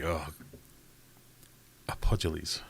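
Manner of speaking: normal talking voice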